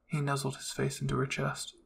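ordinary delivery

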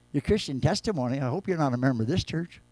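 ordinary talk, nothing marked

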